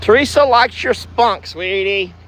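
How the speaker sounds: cooing pet talk. Acted. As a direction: coo it at a favourite animal. Cooing to the cow.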